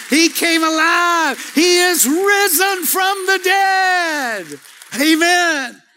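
high, loud, slow proclamation; preaching at full force. Real, not acted. Preacher proclaiming resurrection to the congregation, full-force shout. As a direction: lift the room with it.

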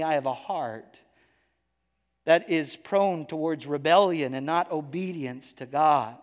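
usual speaking voice

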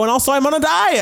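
very high, comic exasperation; high-pitched mock despair; exasperated outburst. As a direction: jump way up in pitch for the final excuse. Comic outburst topping list of excuses.

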